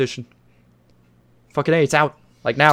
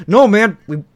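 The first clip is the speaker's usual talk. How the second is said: loud, higher protesting quote; mock demand; 5 semitones higher, 8 dB louder than usual. Fans sending the publisher a clear message, mock demanding. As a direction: burst out as an insistent fan. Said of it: "send a fucking clear message".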